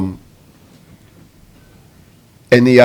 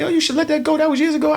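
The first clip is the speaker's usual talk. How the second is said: high, quiet mocking tone of the people who dismiss it; mock them in a high, soft voice; 11 semitones higher, 6 dB quieter, faster than usual. Mocking people who say let it go, in a high, soft voice. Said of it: "And they, they say".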